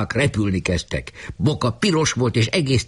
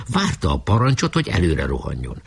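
usual speaking voice

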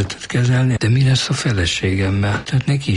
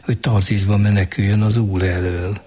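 normal talking voice

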